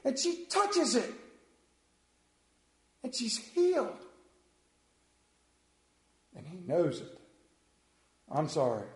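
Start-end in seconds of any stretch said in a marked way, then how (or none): 0.0-4.0 worked up. Real, not acted. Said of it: "I've gotten myself worked up".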